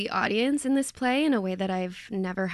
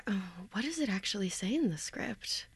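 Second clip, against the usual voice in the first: whispered aside; 9 dB quieter than usual. Real, not acted. Aside asking about the script.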